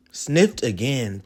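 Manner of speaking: ordinary delivery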